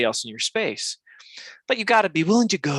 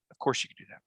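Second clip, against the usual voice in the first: "Of course you could do that" said lower and softer; quiet repeat; 5 semitones lower, 8 dB quieter, faster than usual. Shown as a demonstration. Host repeating the point to listeners, lower and softer. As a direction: drop it down as a calm aside.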